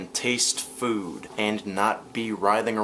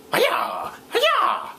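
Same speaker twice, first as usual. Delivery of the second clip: high, louder "Hi-ya!" to the horses; mock buggy driver; 5 dB louder than usual. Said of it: "It'd probably be something like".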